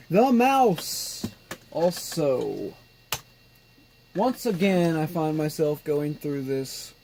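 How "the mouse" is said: "mouse" said higher with a falling, delighted note; excited. Real, not acted. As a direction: raise "The mouse" up high, then let it fall. Repeats spotting the mouse.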